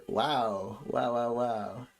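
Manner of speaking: usual speaking voice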